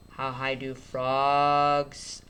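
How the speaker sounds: drawn-out phonics read; stretched out. Shown as a demonstration. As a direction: sound it out slowly. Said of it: "I'm gonna stretch it out".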